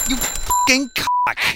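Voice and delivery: high, slow furious yell. Real, not acted. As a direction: scream the insult in rage.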